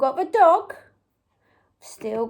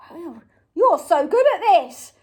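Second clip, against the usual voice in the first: normal talking voice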